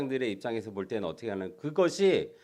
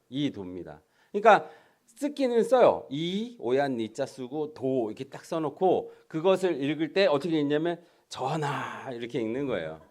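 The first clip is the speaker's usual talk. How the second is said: ordinary delivery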